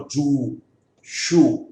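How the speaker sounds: जू, शू with a held oo; isolated long-vowel examples; long oo demo. Shown as a demonstration. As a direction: stretch the oo in जू and शू. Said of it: "ये सब बड़ी ऊ की मात्रा".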